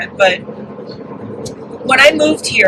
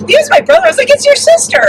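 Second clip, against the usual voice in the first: much higher, louder sing-song; high squeaky voice; 4 dB louder, faster than usual. Retold or imitated. Telling family who's calling.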